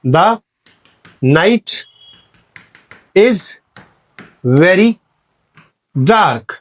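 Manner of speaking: each word set apart with long pauses; dictation pace. Shown as a demonstration. Teacher dictating to students, word by word. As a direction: dictate slowly, one word at a time.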